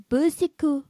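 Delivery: put-on Bernard Bicycle in a hammy French accent. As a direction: mangle the name the French way. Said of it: "you're doing a French accent".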